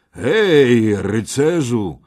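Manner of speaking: loud, drawn-out call to a stranger; hailing shout. A narrator's reading. Kej hails a brooding unknown knight, loud calling shout. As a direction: hail the knight from a distance. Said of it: "zakrzyknął Kej".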